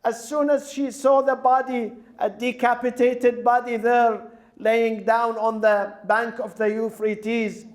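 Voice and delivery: high, ringing preaching voice building through the tragedy; impassioned lament preaching. Acted. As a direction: preach the girl's story at full emotional force, pitched high. High-pitched tragic majlis narration.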